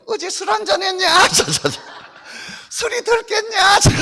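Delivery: high, bold little puppet boasting. Acted. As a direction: brag proudly as the puppet doll. Puppet teases about drinking. (당당하게 자랑하는 높은 인형 목소리)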